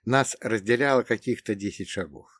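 plain reading voice

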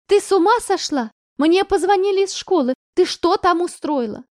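loud, high, furious yelling; mother's shout. Acted. Mother screams at daughter about call from school, furious. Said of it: "Вернее, не голос, крик".

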